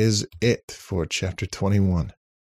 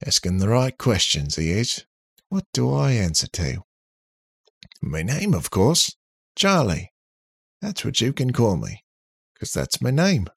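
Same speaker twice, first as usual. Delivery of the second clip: lively, higher-pitched cheeky robot; cocky robot character; 5 semitones higher, 3 dB louder than usual. Acted. Robot telling his makers his own name, cocky. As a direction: introduce yourself with cheeky self-assurance.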